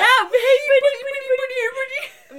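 high cooing voice to a pet; baby talk. Retold or imitated. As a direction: coax a small animal like a baby. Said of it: "singing to a baby or a".

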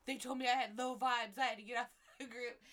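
mom's complaint broken up by laughter; laughing mom quote. Retold or imitated. Retelling mom's complaint about the psychic group, laughing. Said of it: "my mom will be like".

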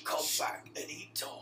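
slow, whispery trader farewell; merchant impression. Player quoting the merchant's goodbye, slow whispery role voice. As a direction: whisper it slowly like the shopkeeper. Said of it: "That's all he says".

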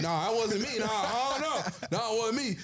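nervous, sheepish retreat; mock denial. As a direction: chicken out fast. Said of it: "You like".

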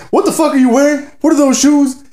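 high, incredulous teacher taking a jab; roasting teacher. Retold or imitated. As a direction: pick on a kid in mock disbelief. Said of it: "the teacher's roasting the shit out of".

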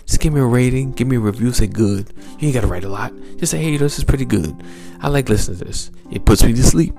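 ordinary delivery

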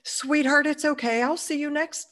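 a light imitation of a gentle client; kind client. Kind client reassuring her.

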